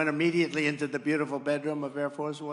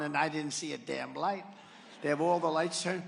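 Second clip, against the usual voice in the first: ordinary delivery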